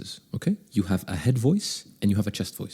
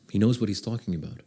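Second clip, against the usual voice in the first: ordinary talk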